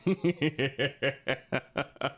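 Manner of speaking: long pulsing laugh; helpless stream of laughter. Real, not acted. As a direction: let a drawn-out laugh run. Laughing at own protest.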